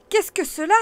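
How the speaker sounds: high, loud startled cry. A narrator's reading. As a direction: cry out at the find. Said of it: "cria-t-il".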